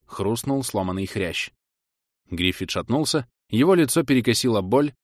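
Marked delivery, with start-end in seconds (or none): none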